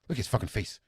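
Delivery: ordinary talk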